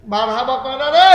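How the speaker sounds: loud, high call rising at the end; rousing call. Real, not acted. Preacher demanding the congregation's marhaba, rousing shout. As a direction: whip the crowd into answering.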